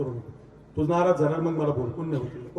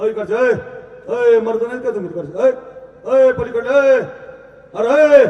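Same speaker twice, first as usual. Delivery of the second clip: high, loud hailing shouts; calling out; 8 semitones higher, 7 dB louder than usual. Real, not acted. Speaker urges the crowd at the back to raise hands, calling out. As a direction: holler to the far side of the crowd.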